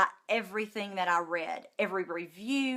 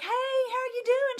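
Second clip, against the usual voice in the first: bright greeting; 14 semitones higher than usual. Retold or imitated. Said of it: "and I was like".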